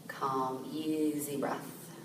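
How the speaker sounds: calm, softened voice with long pauses; guided meditation. Real, not acted. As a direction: gently guide listeners inward, leaving space to breathe.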